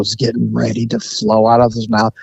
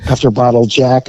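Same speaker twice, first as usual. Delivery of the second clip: normal talking voice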